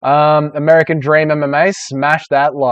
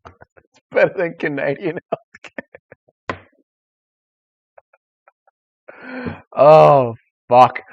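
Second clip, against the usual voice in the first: helpless real laughter through the words; laughing hard; 2 semitones higher, slower than usual. Real, not acted. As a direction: barely get it out for laughing. Cracks up repeating his own joke.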